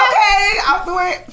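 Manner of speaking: high, loud, laughing okay; laughing excited yes. Retold or imitated. To the teacher offering extra pieces, a laughing excited yes. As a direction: jump at the chance, laughing. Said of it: "So I was like".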